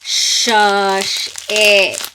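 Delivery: ordinary talk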